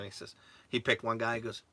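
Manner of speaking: ordinary talk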